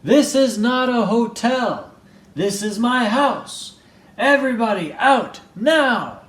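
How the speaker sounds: loud, high angry yelling; furious shout. Acted. Bruce ordering the guests out of his house, yelling. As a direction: throw everyone out at full volume. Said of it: "Bruce lost his temper".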